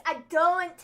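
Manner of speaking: "don't" slow, loud and drawn out; corrective demo. Shown as a demonstration. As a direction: stretch "don't" to show how it goes. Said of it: "Don't sounds different than don't".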